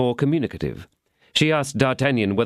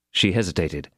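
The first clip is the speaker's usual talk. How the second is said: usual speaking voice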